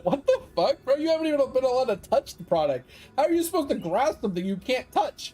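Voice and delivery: high-pitched, baffled mate sticking up for him; indignant buddy. Retold or imitated. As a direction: voice the friend's outraged disbelief.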